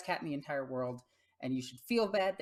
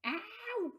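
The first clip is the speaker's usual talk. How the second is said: high startled yelp of pain; sharp yelp. Real, not acted. Cat leaping off her lap, sudden high yelp. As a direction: yelp as claws dig in.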